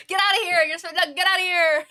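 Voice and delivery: loud, high, laughing get outta here; laughing shout. Real, not acted. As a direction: shoo it away with a laughing yell. Shooing a Pokemon off screen.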